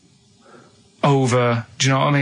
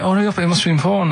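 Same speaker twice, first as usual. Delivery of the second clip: higher, quick imitation of urging; mild retold urging; 5 semitones higher, faster than usual. Man retelling his girlfriend urging him to answer, mildly urgent. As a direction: lightly mimic someone hurrying you along.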